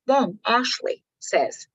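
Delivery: normal talking voice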